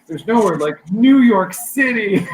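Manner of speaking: high, loud, slow showbiz gush; mock theatrical New York devotee; dramatic Broadway. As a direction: belt it out like an over-dramatic Broadway enactment. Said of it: "over a dramatic Broadway".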